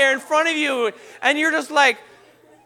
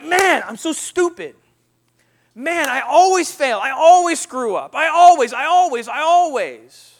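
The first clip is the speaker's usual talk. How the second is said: louder, frustrated self-scolding; frustrated self-talk; 5 semitones higher, 7 dB louder than usual. Retold or imitated. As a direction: beat yourself up out loud. Voicing own self-criticism.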